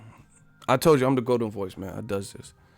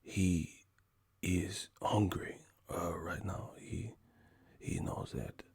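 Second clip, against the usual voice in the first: slow soft whisper in third person; Cotto-style whisper; 5 semitones lower, 13 dB quieter, slower than usual. Retold or imitated. Comic imitating Cotto's press talk, whispering. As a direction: breathe it out like a timid fighter. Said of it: "I'ma start talking".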